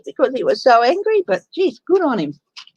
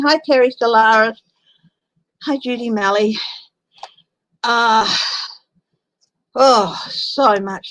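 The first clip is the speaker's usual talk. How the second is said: usual speaking voice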